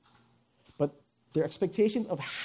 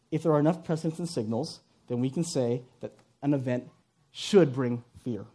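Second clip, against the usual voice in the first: ordinary delivery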